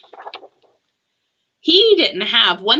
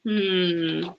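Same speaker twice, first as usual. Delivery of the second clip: plain reading voice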